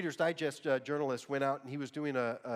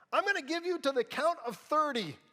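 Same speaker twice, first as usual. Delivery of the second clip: high, loud threatening yell; imitating a mom's yell; 13 semitones higher, 9 dB louder than usual. Mom threatening her kid from forty feet, loud high yell. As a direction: bark a threat across the church like the mom. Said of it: "These were her exact words".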